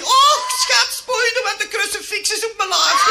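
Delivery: high, loud hammed-up yell; panicked cry. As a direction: cry out in mock alarm. Groom cries out in joke punchline. (luide, hoge paniekkreet)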